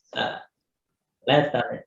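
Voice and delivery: ordinary talk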